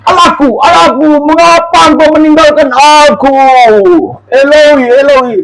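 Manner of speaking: high anguished call; crying out. Acted. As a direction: call out to God, pleading. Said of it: "tadi sudah berseru-seru".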